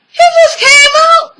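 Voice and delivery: very high-pitched squeak of disbelief; stunned disbelief. Retold or imitated. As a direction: squeal it like a shocked onlooker. Voices stunned onlookers.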